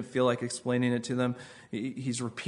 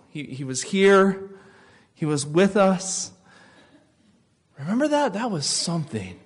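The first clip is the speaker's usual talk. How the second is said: higher, eager remembering; wistful excitement; 6 semitones higher than usual. Retold or imitated. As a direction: recall the good days with rising wonder. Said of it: "They're saying".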